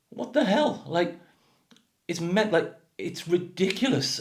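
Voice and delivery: very high-pitched, baffled disbelief; incredulous exasperation. Real, not acted. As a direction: squeak it out in bewildered protest. Exasperated reaction to absurd accents.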